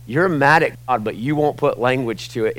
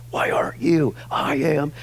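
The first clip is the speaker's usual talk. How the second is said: mock confrontational, slightly raised pitch; lightly imitated self-righteous scolding; mildly harsh challenge; 4 semitones higher than usual. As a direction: play a touch of old harshness. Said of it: "there's no harshness in me anymore".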